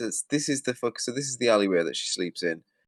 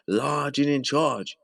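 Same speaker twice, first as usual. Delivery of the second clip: drawn-out, higher-pitched mock swagger; swaggering attitude; 5 semitones higher, much slower than usual. Announcing her arrival with attitude, mock swaggering. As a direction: stretch it out with big attitude. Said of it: "here she comes with the attitude".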